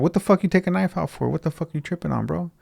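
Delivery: high, incredulous questioning without shouting; retold indignant disbelief. Retelling a knife confrontation, indignant.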